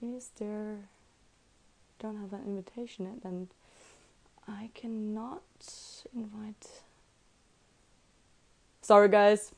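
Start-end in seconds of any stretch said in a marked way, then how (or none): 0.0-6.8 very soft, half-whispered muttering; real, barely audible self-talk. Real, not acted. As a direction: think aloud under your breath while troubleshooting. Muttering to self while fixing invitation.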